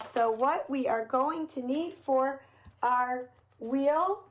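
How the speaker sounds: ordinary delivery, nothing marked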